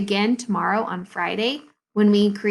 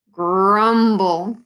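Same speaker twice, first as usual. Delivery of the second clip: loud, drawn-out grumbling word; stomach grumble; 5 dB louder than usual. A narrator's reading. Mister Putter's stomach complains after too many jelly rolls, grumbling. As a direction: make the word rumble like a belly. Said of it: "grumbled his stomach".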